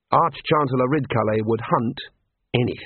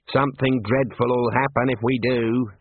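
plain reading voice

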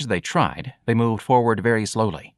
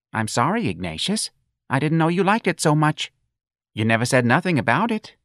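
higher-pitched apology with rising pleading tune; apologetic mother; 7 semitones higher than usual. Acted. Mother excusing herself to upset son, apologetic. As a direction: plead gently that you didn't know.

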